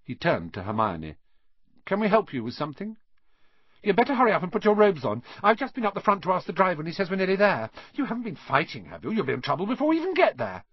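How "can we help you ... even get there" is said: high, rapid, bossy scolding; bossy girl. Acted. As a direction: rattle off orders in a high voice. Voices Hermione.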